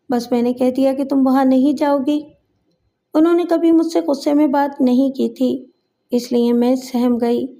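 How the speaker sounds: plain reading voice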